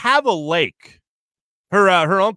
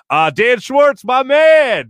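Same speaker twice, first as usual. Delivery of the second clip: loud, high shout-out to a listener; excited greeting; 8 semitones higher, 7 dB louder, much slower than usual. Real, not acted. Host greets a listener with a loud, high shout-out. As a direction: hype up the name.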